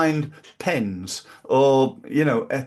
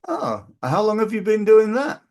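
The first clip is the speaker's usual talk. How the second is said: eager curious question; 8 semitones higher, 5 dB louder than usual. Shown as a demonstration. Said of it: "I'm gonna go".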